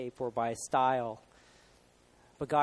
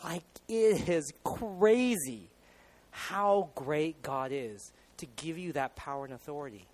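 normal talking voice

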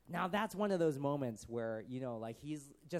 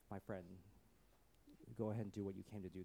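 very soft, low, tender; hushed, sincere affection; loving; 8 semitones lower, 12 dB quieter, faster than usual. Shown as a demonstration. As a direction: speak gently to someone you love. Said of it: "it was almost in this loving sense".

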